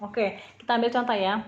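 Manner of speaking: ordinary talk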